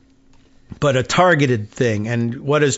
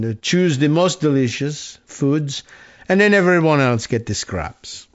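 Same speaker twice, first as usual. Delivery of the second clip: Emmanuel Macron. Retold or imitated. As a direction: put on a French accent for the read-out message. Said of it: "trying to do a French accent".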